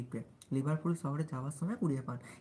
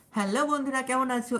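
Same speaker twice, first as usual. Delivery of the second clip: high, loud hello to friends; put-on greeting voice; 8 semitones higher, 8 dB louder than usual. Shown as a demonstration. Streamer showing followers their usual greeting, high put-on voice. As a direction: pitch it up and project the greeting. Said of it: "এরকম করে বলি বলে".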